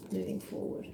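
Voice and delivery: very soft, breath-only whisper; hushed, private repetition; whispered echo. Real, not acted. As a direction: repeat it to yourself in a whisper. Card message echoed to herself.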